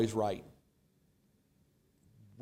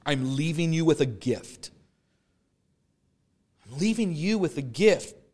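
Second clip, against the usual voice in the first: lifted, louder reading of Jesus' words; impassioned scripture reading; 2 semitones higher, 5 dB louder than usual. Acted. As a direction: proclaim the promise with fire. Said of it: "Jesus ends like this, and he says it".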